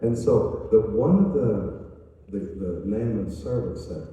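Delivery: ordinary talk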